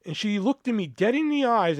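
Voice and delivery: usual speaking voice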